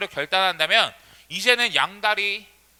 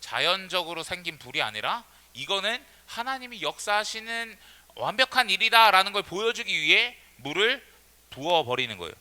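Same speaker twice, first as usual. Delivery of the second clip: normal talking voice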